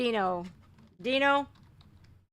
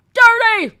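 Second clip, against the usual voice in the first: high, loud shouted Dirty!; mock outraged cry of Dirty!; 12 semitones higher, 12 dB louder than usual. Shown as a demonstration.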